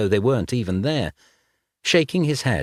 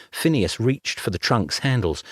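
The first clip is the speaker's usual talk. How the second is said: ordinary delivery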